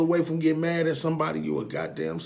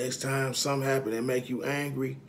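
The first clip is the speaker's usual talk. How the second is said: normal talking voice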